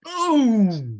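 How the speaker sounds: loud, high impact call; dramatic boom. Acted. GM announcing the huge hit on Mia, loud and high. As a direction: punch it out like a blow landing.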